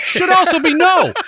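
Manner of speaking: high, loud and slow punchline; emphatic. Real, not acted. Wife stressing the answer to her husband. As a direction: land it hard and slow.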